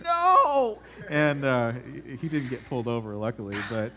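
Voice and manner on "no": high, loud comic shriek; mock shriek. Dad as the board hits in front of the cop, shrieking no. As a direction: cry it out as the board falls. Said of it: "He's like".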